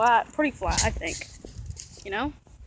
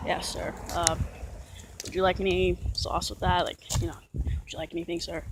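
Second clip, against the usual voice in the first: voice deepened; 4 semitones lower, 6 dB quieter than usual. Retold or imitated. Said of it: "let me get into character".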